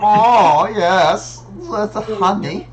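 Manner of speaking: put-on loud, high, relishing German accent. Player savouring the honey line, in a German accent. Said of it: "I think it's German".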